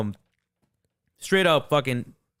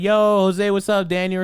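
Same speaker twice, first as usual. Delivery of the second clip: loud, high-pitched call-out; loud greeting; 7 semitones higher, 5 dB louder than usual. Pretend. Friends greet Jose by name, loud high greeting. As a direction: hail a buddy loudly and high.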